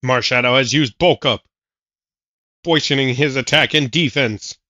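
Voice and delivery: play-by-play announcer. Acted. Announcer calling the battle.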